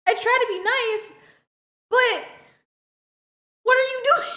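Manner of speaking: high, strained fake-nice voice; high fake-polite question to the neighbor; mock polite reply. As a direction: force a sweet tone while baffled. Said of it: "And I'm like".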